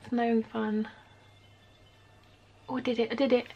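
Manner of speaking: ordinary talk, nothing marked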